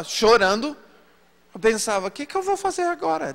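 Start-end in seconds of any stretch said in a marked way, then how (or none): 2.1-3.3 panicked. Retold or imitated. Panicked thought while crying.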